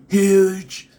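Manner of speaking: huge shouted high and loud; emphatic loud huge. Shown as a demonstration. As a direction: belt out huge big. Repeating huge with build.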